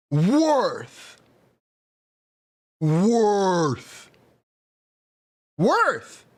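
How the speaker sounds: high, drawn-out game announcer call. Acted. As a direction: belt it like an arena announcer. Recording voice clip takes.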